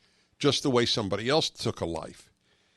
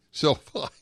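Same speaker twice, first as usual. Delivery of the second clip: real laugh breaking up the words; amused chuckle trailing into a laugh; 3 semitones higher than usual. Real, not acted. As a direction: laugh it off, tickled. Host amused by caller's joke.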